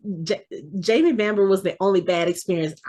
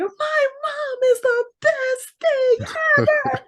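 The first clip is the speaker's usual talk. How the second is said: ecstatic post. Retold or imitated. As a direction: gush through happy tears. Daughter's ecstatic social media post.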